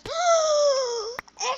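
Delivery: huge loud high gasp; hammed-up scandalized gasp; shocked gasp. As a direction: gasp in exaggerated outrage. Lady's shocked reaction retold.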